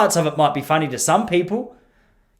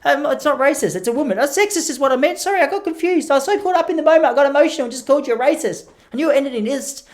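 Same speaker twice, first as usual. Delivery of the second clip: high, fussy flustered voice, backpedalling fast; high mock-flustered critic scrambling to take it back; 9 semitones higher than usual. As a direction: squeak out a panicky apology.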